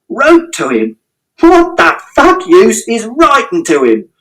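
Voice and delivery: very high, slow, contemptuous yell; mock her letters with rage; scornful shout. A narrator's reading. Father shouting scorn at mother. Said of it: "And Father shouted".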